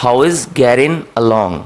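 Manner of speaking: slow, getting said as gerin with no final g; "How is getting along" with getting as gerin, slowly; dropped-g American getting; American flapped getting. Shown as a demonstration. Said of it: "वो जी का sound pronounce नहीं करते".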